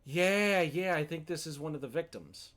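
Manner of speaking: excited realisation. Real, not acted. Streamer spotting the victim in the files, loud and excited. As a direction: blurt it out as it clicks.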